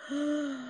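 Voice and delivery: soft drawn-in gasp; a quiet gasp of dread; acted gasp. Animals hearing nothing at Duck's door, soft gasp.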